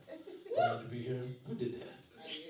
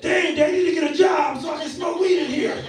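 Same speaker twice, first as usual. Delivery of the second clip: joking shout; 9 semitones higher, 10 dB louder than usual. Acted. Comic shout to crowd.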